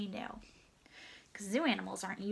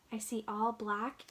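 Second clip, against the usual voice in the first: ordinary delivery, nothing marked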